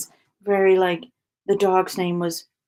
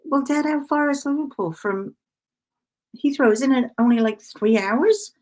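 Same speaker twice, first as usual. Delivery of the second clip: incredulous question; 6 semitones higher, 4 dB louder than usual. Retold or imitated. Incredulous daughter questioning her dad.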